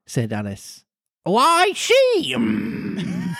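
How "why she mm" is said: very high squawky creature voice; Griffin voice. Acted. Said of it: "said the Griffin".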